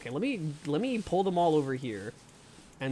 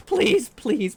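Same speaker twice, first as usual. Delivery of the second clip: loud, high begging; real frantic plea; desperate pleading; 9 semitones higher, 7 dB louder than usual. Real, not acted. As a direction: cry out pleas in panic. Begging while attacked in game.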